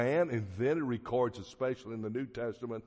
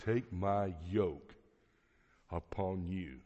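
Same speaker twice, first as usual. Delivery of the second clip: slow, lower, measured scripture quote; spaced-out words, sinking softly at the end; solemn quote of Jesus; 3 semitones lower, 3 dB quieter than usual. Retold or imitated. Preacher urges listeners to heed Jesus, slow solemn quote. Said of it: "as Jesus says to us".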